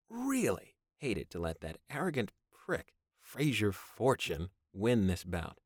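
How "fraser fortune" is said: usual speaking voice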